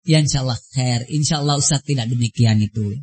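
low, soft quoted reassurance; gentle reassurance. Retold or imitated. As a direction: calmly wave the worry away, imitating a patient teacher. Voicing the ustadz soothing a complainer.

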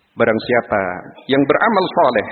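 ordinary delivery

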